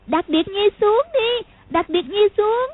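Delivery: put-on high, slow scared child pleading; a little girl crying out in fear; frightened cry. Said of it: "vội kêu lên". (giọng bé gái sợ hãi kêu lên)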